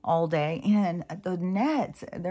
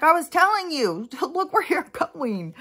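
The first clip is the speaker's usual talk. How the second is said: put-on high, loud scolding old man; high mock scolding; 9 semitones higher, 4 dB louder than usual. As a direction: tell her off like an old man. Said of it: "Even the old Russian man, he's like".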